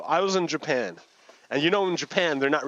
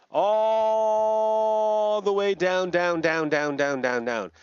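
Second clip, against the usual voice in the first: long descent; 6 semitones higher, much slower than usual. Acted. Storyteller sending silk into darkness, drawn-out descending voice.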